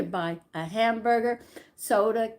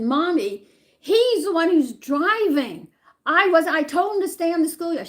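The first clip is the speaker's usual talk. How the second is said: put-on pleading child; 8 semitones higher, 8 dB louder than usual. Child pleading with angry mother.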